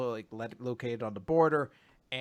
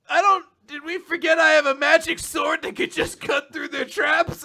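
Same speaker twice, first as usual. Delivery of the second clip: much higher, louder hammed-up yell; mock Asta shout; 13 semitones higher, 8 dB louder than usual. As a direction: yell it like the hero with the sword. Imagined Asta quote, shouting.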